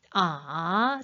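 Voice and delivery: 아 dipping low before rising; Mandarin third tone. Shown as a demonstration. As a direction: sink 아 down, then bring it up. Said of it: "삼 성은 어떻게 해?".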